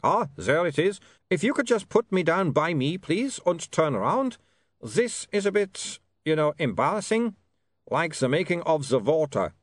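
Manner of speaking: put-on high, accented vampire asking for privacy; vampire Otto, embarrassed. A narrator's reading. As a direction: ask delicately as an awkward vampire.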